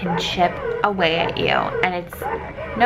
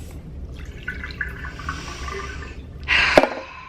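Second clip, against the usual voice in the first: heavy sigh; 4 dB quieter than usual. Real, not acted. Dumping out pumped milk.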